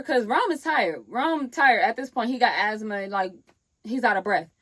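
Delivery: normal talking voice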